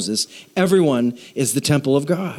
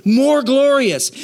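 more glorious stretched slow and high; drawn-out refrain; 9 semitones higher, 3 dB louder, slower than usual. Acted. Preacher stretching the refrain for the church. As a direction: lean on more, slowly.

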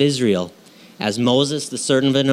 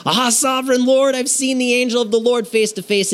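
much higher, alarmed cry of Gideon; panicked high-pitched Gideon; Gideon exclaiming; 12 semitones higher than usual. Acted. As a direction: cry out in shock at seeing the angel. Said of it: "he exclaimed".

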